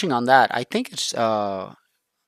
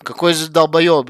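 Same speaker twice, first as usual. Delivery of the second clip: louder, slower Russian exclamation; imitating Russian friends' loud disbelief; incredulous Russian quote; 4 semitones higher, 8 dB louder than usual. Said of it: "and in Russian, they're like".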